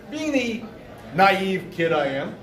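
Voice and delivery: usual speaking voice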